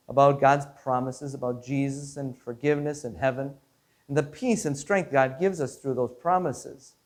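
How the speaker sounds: usual speaking voice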